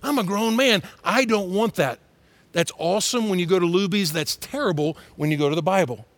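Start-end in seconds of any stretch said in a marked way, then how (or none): 0.0-2.0 mock defiant.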